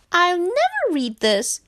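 high-pitched complaining frog voice; despondent frog. A narrator's reading. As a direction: give up in a high little frog wail.

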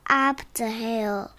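ordinary delivery

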